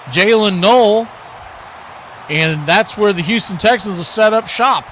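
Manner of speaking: ordinary talk, nothing marked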